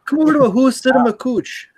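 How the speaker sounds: exaggerated Wisconsin accent, very high; Midwest accent. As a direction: play a folksy Wisconsin host. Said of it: "that's more Midwest".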